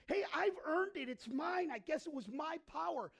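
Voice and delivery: high, loud self-congratulating brag; loud, smug boasting to himself; proud boast. Retold or imitated. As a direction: puff up and claim the credit. Preacher voices own boast.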